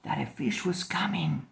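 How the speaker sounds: hushed storyteller whisper; narrating in a tense whisper; whispered narration. A narrator's reading. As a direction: whisper it so the fish won't hear. Tense silent fishing moment.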